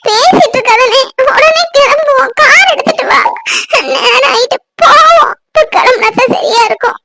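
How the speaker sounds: high, distraught, pleading orders; tearful urging. Acted. Woman begging to drive to the injured man, tearfully urgent. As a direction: urge them to hurry through tears.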